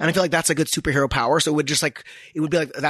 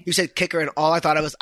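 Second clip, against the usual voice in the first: normal talking voice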